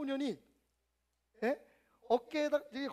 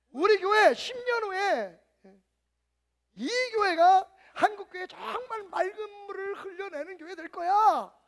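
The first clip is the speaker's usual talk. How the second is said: higher, louder, slow, voice catching; grand bold proclamation; 8 semitones higher, 10 dB louder, slower than usual. Retold or imitated. Believer proclaiming the church's future, loud and tearful. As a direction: declare it like a tearful vision.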